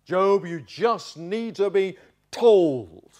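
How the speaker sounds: slow, emphatic loud rebuke; heated, hectoring telling-off; loud, dogmatic. Acted. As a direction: lay down the law, word by word. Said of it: "It's just loud".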